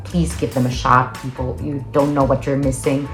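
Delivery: ordinary delivery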